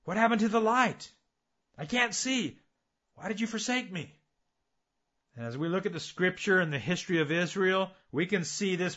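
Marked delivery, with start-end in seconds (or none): 0.0-4.1 imitated crying out. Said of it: "they start crying out".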